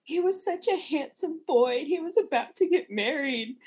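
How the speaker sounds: mock-wail over her handsome son; mock lament. Imitating a mother lamenting her injured son, hammed-up mournful wail. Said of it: "Missus Weasley is lamenting".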